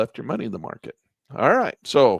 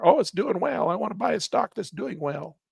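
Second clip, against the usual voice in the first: high-pitched mock eager buyer; 7 semitones higher than usual. Retail traders wanting to buy up here, mock eager. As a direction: imitate a naive buyer in a raised voice.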